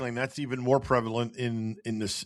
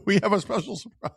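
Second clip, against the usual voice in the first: higher game show host teasing a prize; 9 semitones higher than usual. Pretend. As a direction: build suspense like a TV quiz host. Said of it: "I sound like a game show host".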